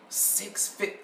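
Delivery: breathless whisper of the price. Retold or imitated. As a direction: whisper it in disbelief. Muttering price to herself.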